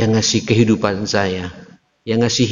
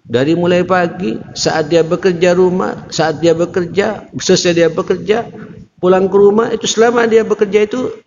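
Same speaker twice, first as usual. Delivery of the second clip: building sermon climax; 9 semitones higher than usual. Acted. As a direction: build each phrase toward the climax. Preaching build.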